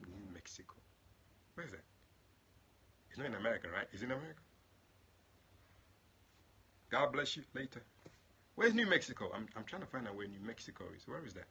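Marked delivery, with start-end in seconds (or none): 0.1-1.8 whispered New Mexico; muttering to himself. Real, not acted. Preacher puzzling over a viewer's state, whispered. 8.6-9.3 high, loud bewildered cry; puzzled exclaim. Real, not acted. Preacher baffled by a viewer's location, loud puzzled exclaim.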